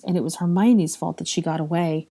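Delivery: plain reading voice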